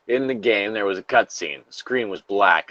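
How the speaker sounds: usual speaking voice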